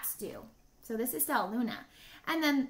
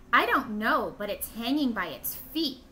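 usual speaking voice

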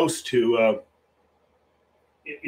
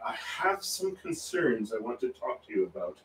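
low, soft, lightly imitated scolding; Queen Elizabeth; 4 semitones lower, 8 dB quieter than usual. Retelling what the Queen told the nation, low and mildly scolding. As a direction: quietly voice a monarch's gentle reproof. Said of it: "Kind of scolded the nation".

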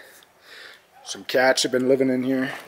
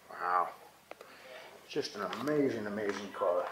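softer, awed murmur; quietly marveling at the car; hushed awe; 3 semitones lower, 13 dB quieter than usual. Real, not acted. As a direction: let real awe drop your voice right down.

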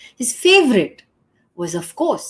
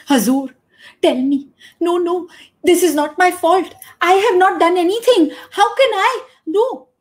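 high, loud frantic protest; panicked denial; 11 semitones higher, 6 dB louder than usual. Acted. Cloth merchant protests his innocence to the king, high and frantic. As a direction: deny it in a rising panic.

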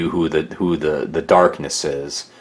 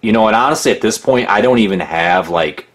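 ordinary talk, nothing marked

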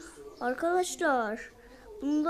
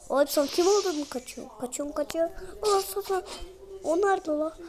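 ordinary delivery

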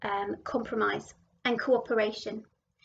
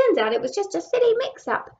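higher, louder and quicker worm; little worm voice; 7 semitones higher, 6 dB louder, faster than usual. Acted. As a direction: pipe up as a tiny worm. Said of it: "said a little worm".

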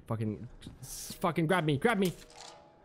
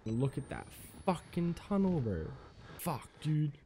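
ordinary talk

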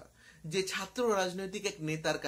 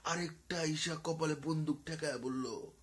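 mock schoolboy thrill; 5 semitones lower, 8 dB quieter than usual. Schoolboy to friends huddled round a phone video, hushed mock thrill. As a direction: act out a schoolboy quietly getting turned on. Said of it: "high স্কুলের ছেলে পেলে গোল হয়ে".

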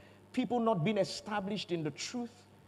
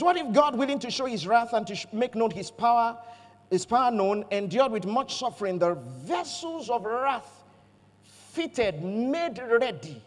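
louder, declaiming the verse; scripture reading; 2 semitones higher, 4 dB louder than usual. Real, not acted. Preacher reading Romans nine aloud to the church. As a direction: read the verse out with weight.

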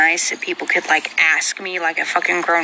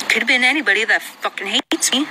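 normal talking voice

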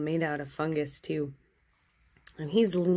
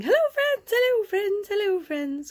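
cheery monster greeting; 6 dB louder than usual. Pretend. Happy tree monster waving to viewers, excited high greeting that fades. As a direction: chant hello over and over, winding down. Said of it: "I imagine she's just going".

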